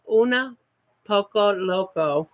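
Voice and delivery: Una Poco Loco split apart, each word placed slowly; slow down so Una Poco Loco comes out right; Una, pause, then Poco Loco said deliberately; careful slow name. Real, not acted. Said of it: "I can't say that too fast or I'll mess her name up".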